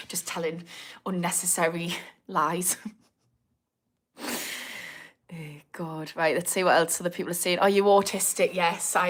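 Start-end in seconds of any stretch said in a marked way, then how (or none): none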